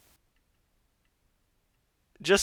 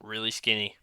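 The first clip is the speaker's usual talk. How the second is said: ordinary talk, nothing marked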